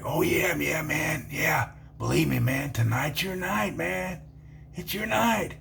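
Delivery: hushed insistence. Retold or imitated. The evil-looking guy repeats his warning, hushed and insistent. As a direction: insist in a knowing near-whisper.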